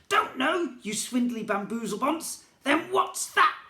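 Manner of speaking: high, loud mocking goblin echo; angry goblin echo. A narrator's reading. Goblin prince mocking the boy's answer, high and loud. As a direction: throw the words back at him. Goblin grabs boy, demands.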